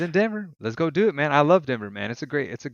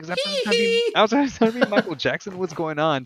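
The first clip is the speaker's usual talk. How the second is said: high, loud bewildered questions; baffled; 9 semitones higher, 3 dB louder than usual. Retold or imitated. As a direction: sound baffled and amazed.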